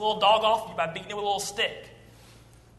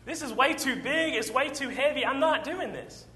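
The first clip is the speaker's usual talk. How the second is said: whiny David; 6 semitones higher, 3 dB quieter than usual. Retold or imitated. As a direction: whine under heavy armor. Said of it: "He's like".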